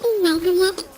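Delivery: ordinary delivery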